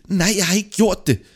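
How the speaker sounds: high, loud shouted refusal; defiant high-pitched cry; stubborn denial. Acted. Drowning man stubbornly denies again.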